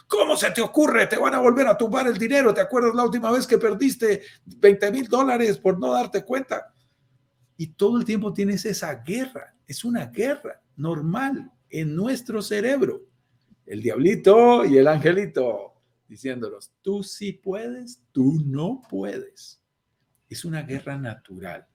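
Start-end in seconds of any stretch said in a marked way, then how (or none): 0.1-6.7 voz mucho más aguda, rápida y alarmada; regaña asustando con el pasado; diablito alarmado. Pretend. El diablito discute en la guerra. 16.8-19.6 contraste lento. Pretend. Angelito y diablito se contradicen.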